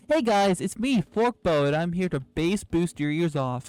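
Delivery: high, very loud YouTuber greeting; blasting loud intro. Acted. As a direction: yell the intro into the mic. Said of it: "And then sometimes I'm like".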